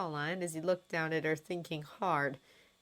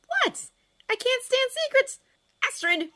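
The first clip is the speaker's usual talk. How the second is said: exasperated outburst; 7 dB louder than usual. Acted. Fishlegs bursts out.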